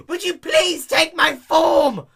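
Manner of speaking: high, loud, slow mocking snap; spat back. A narrator's reading. Dash mocking the clerk's request, loud sarcastic snap. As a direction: throw the clerk's words back. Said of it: "Dash spat back".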